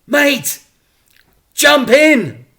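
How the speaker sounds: high, loud urgent plea; begging. Acted. Dad begs his son to jump in, high and loud. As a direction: beg the boy to jump. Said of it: "begged Dad".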